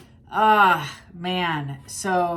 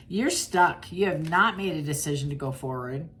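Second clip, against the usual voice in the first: ordinary talk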